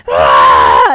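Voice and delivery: a startled parent yelps in alarm; overreacting parent shriek. Parent yelps at a kid confessing drugs, mock loud shriek. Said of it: "and you're like".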